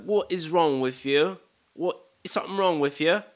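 high, loud mock British disbelief; horrible British accent. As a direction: imitate an appalled British friend. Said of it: "sorry for this horrible accent".

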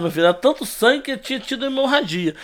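normal talking voice

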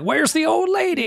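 high-pitched taunting call; mock jeering passers-by. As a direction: jeer in a squeaky put-on voice. Acts out jeering trash-throwers.

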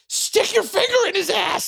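high, loud shrieked order; Whitney yelling advice mid dog fight; frantic command. Retold or imitated. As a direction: shriek the instruction in a panic.

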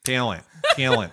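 Toledo accent, "Talent" stretched to "tee-alent". Shown as a demonstration. Podcaster demonstrating for laughing co-hosts, Toledo accent. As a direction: exaggerate the Toledo vowel in "talent". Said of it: "the best example that I have".

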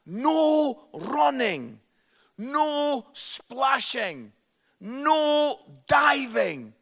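loud, slow, stern lifeguard orders; barked pool rules. Retold or imitated. Quoting swimming pool rules to the crowd, loud and stern. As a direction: spell out each rule like a strict lifeguard.

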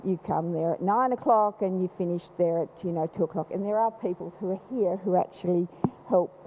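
ordinary talk

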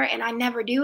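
usual speaking voice

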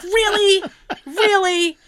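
high-pitched, exasperated disbelief; incredulous. Retold or imitated. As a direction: question it high, in comic disbelief. Incredulous that lavatory worked.

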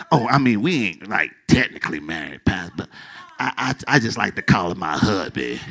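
sheepish. Acted. Playing a sheepish churchgoer.

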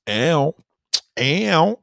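high drawn-out "ow" catcall; flirty rising howl of "ow"; signature catcall. Shown as a demonstration. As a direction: call out "ow" with swagger. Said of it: "you gotta do it like me".

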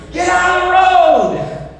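put-on high, urgent yell at children; acted parental yell. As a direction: yell a warning from the front door. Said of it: "I yell at my children".

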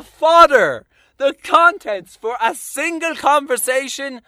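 loud, slow furious rant; slow, loud, raging tirade. Real, not acted. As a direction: build the rant hard. Ranting crescendo.

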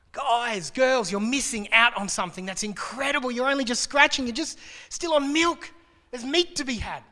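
frustrated for them. Retold or imitated. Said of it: "frustrated for them".

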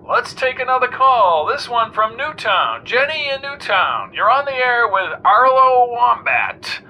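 jaunty talk-radio host. A narrator's reading. Arlo Wombat quizzing the weatherman on air, breezy host.

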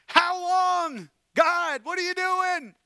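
high, loud cry of complaint; an anguished, loud cry to God; desperate cry. Acted. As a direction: shout the question at heaven. Acted lament to God.

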